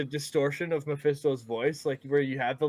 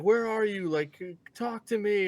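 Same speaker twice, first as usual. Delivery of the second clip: higher-pitched mock calling out; imitated calling out; 6 semitones higher than usual. Podcaster acting out a man summoning a demon, calling out.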